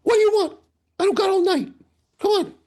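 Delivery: high squeaky grouch voice; Hammer's grumpy voice. Retold or imitated. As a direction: bark it out like a cranky old barman. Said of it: "if he's bartending, like".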